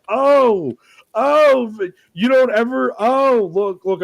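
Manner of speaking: high, loud, sputtering disbelief; excited outburst. Retold or imitated. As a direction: caught you, gleefully indignant. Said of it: "And I was like".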